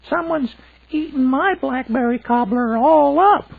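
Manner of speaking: very high, loud little cub voice; Baby Bear voice. A narrator's reading. Baby Bear wailing that his cobbler is gone, very high and loud. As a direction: squeak it like a tiny upset cub.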